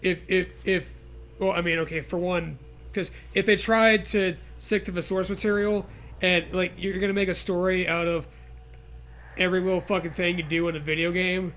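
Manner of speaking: ordinary talk, nothing marked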